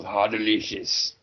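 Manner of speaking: slow, savoring Iron Chef impression; drawn-out mock relish; pepper-eating chef. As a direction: savor it slowly, imitating the TV chef. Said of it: "he's the guy who eats the pepper. He goes".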